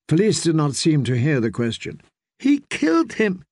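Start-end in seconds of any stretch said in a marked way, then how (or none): 2.4-3.4 much higher, trembling grief; a terrified lady quavering out the news; quavery voice. Acted. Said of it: "she said in a quavery voice".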